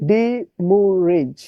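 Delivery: "ডিমুরেজ" slowly in three long syllables; distorted spelling pronunciation. Shown as a demonstration. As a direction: stretch each syllable of "ডিমুরেজ". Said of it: "বিকৃত উচ্চারণ করবেন". ("ডিমুরেজ" টেনে টেনে, ভেঙে উচ্চারণ)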